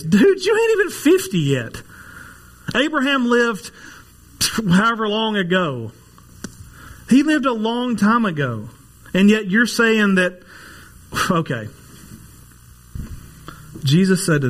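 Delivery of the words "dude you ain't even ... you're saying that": higher, incredulous mock challenge; mocking skeptics. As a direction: play the doubters scoffing at him. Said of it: "the question you would say is".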